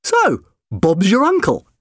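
high, loud, slow and pleased; delighted. A narrator's reading. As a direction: draw it out with delight.

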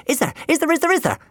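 breathlessly eager, rapid repeated question; impatient, excited pestering. A narrator's reading. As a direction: fire it off over and over, bursting with excitement. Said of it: "Jim asked excitedly. He was hopping".

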